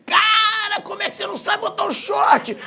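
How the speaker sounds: imitating a kid's high-pitched yell of disbelief; incredulous shout. Boy to his cousin over the shorts, incredulous shout. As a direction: shout it way up high, like an astonished child.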